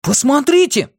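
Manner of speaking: high-pitched exclamation; восклицание. A narrator's reading. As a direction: call your friends to look, pitch leaping up. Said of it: "воскликнул Бенни". (высокий мальчишеский возглас)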